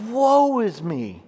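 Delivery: "Woe" drawn out long and emphatic, then falling; emphatic lament. Acted. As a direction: stretch the word "Woe" slowly in acted anguish. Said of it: "emphatic pronunciation in the Hebrew language".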